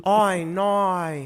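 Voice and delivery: exaggerated Australian "oh, no"; Australian accent. Shown as a demonstration. Host demonstrating for co-host, broad Australian accent. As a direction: stretch both vowels in broad Australian. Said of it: "then you do the accent".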